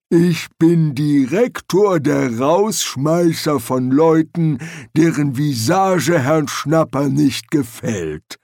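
lumbering troll, drawn-out words; unruffled troll. Acted. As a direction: answer slowly, completely unbothered. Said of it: "sagte der Troll".

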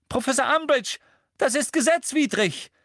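hoher, lauter Protest; schreiend. A narrator's reading. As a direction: schrei deinen Protest heraus. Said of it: "schrie Hermine". (empört und hoch geschrien)

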